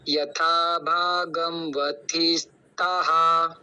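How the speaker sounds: यथा भागम वत्थिस्ताः chanted very slowly, visarga heard; slow recitation. Shown as a demonstration. Teacher recites the whole phrase slowly. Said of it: "तो".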